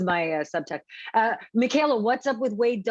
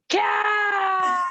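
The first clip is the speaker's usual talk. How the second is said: full scream; 11 semitones higher than usual. Acted. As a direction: belt it to the top of her lungs. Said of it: "The screaming part".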